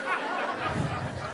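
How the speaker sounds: a silent, high giggle at his own joke; a quiet, amused laugh; high breathy laugh. Real, not acted. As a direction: chuckle under the breath. Joke punchline, storyteller laughs.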